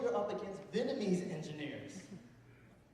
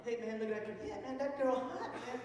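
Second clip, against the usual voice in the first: higher, mock Vietnamese accent; friend's Vietnamese accent; 7 semitones higher, 5 dB quieter than usual. Friend pointing out a girl, Vietnamese accent. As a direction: imitate a thirty-something buddy with a Vietnamese accent. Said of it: "he has a Vietnamese accent".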